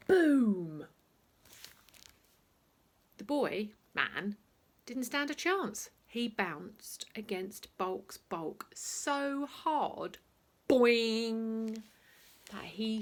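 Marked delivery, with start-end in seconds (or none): 0.1-0.9 big booming sound word; loud boom. A narrator's reading. Narrator punctuates the tackle with a loud boom. 10.7-11.8 long loud springy boing; bouncy boing. A narrator's reading. Narrator voices the boy bouncing off, loud boing. Sound effect.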